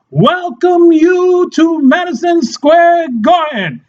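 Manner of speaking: slow, high, stretched announcer call; mock fight-night introduction; ring announcer. Shown as a demonstration. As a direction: announce it to the arena, drawing out each word. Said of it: "You have to say it this way".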